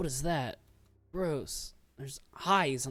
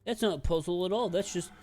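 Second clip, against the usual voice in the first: loud, higher scoffing imitation; mocking whiny voice; 6 semitones higher, 6 dB louder than usual. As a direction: mimic the complainers, dismissive. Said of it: "as some people say".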